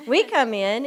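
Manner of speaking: excited restart of we come in. Real, not acted. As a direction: burst out with we come in, thrilled. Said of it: "oh, that's exciting".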